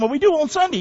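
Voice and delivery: high, breezy mimicry of a lukewarm believer; a hint of a mock churchgoer; churchgoer's excuse.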